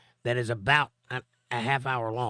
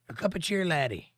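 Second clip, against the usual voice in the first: put-on Irish accent; 5 semitones higher than usual. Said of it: "I can do a pretty mean Irish accent".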